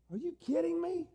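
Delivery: high-pitched disbelief; incredulous. Acted. Believer answering Paul's impossible command, incredulous. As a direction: balk at it, voice shooting up.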